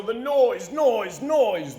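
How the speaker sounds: ordinary delivery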